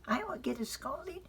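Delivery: high, small questioning voice; a child asking innocently; curious little girl. Acted. Frances asking Father why the curtains move.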